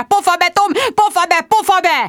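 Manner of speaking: high, loud, frantic shouting; furious yell. A narrator's reading. Sharon to Tom recording over the tape, yelling loud and high. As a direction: shout him down.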